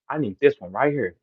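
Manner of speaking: hushed and low, close to a whisper; quiet repeated quote. Retold or imitated. Repeating Jay Mills' words to the audience, hushed. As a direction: say it under his breath as the quote again. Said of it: "He didn't do that for y'all".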